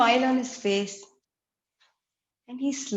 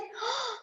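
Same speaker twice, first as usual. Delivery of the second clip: gasp; 11 semitones higher, 8 dB quieter than usual. Acted. Izo sees the welcome.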